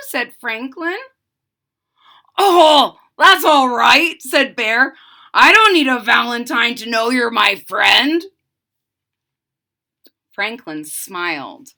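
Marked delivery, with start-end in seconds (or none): 2.4-8.2 louder, kindly bear voice; big friendly reassurance; reassuring Bear. Acted. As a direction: Bear comforting Franklin, loud and kind.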